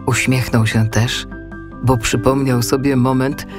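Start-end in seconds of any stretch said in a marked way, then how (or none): none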